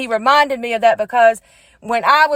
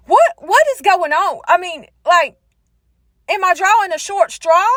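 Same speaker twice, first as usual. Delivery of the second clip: high, louder exasperated questioning; mock frustration; 5 semitones higher, 4 dB louder than usual. Woman praying while doors keep shutting, high frustrated complaint. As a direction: demand answers in comic exasperation. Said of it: "I've just been getting frustrated".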